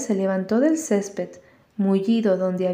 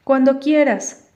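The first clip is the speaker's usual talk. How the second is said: higher, louder ready retort; defiant reply; 5 semitones higher, 5 dB louder than usual. A narrator's reading. Walter answers Dan's threat, firm and defiant. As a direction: take up the challenge firmly. Boy accepts the challenge.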